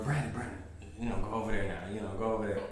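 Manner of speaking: low, soft urging; quiet, low-pitched instruction; hushed manager. Retold or imitated. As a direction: imitate a manager discreetly giving orders. Manager quietly sends coworker over, retold.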